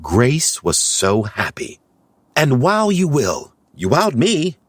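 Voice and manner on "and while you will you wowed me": excited. Acted. Grace's excited dialogue.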